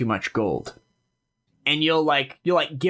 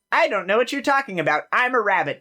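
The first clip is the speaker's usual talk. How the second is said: fast, lightly higher feigned innocence; innocent rabbit; 7 semitones higher than usual. Acted. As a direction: play dumb like a cheeky rabbit. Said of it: "be like".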